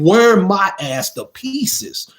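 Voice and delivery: ordinary talk, nothing marked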